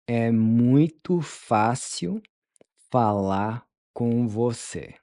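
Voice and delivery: "É muito fácil" drawn out, then "falar com você"; slow it down, chunk by chunk; slow chunked model. Shown as a demonstration. Teacher modeling the phrase slowly for students. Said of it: "How would you tell them".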